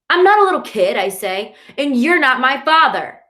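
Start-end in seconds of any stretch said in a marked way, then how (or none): 0.1-1.0 put-on high, loud childish yell; angry shout. A narrator's reading. Kids quarrel. 1.8-3.2 higher, louder furious yell. A narrator's reading. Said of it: "I yell those last words".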